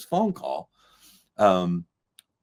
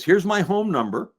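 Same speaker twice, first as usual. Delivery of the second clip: high-pitched director voice; light, high impression of a director; David Lynch; 8 semitones higher than usual. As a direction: offer it briskly in a raised phone voice. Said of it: "He says".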